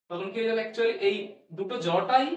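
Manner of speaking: normal talking voice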